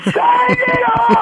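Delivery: very high, long drawn-out crowd yell; imitating crowd scream. Guest imitating the arena crowd screaming back, very high. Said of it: "they're just screaming".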